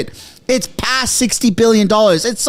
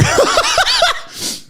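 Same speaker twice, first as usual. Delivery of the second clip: giddy laugh. Real, not acted.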